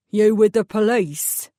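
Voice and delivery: slow, gruff East End question; an East End mechanic sizing up a stranger; suspicious East End accent. Acted. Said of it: "distinctly East End accent".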